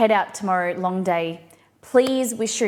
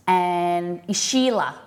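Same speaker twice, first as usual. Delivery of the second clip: normal talking voice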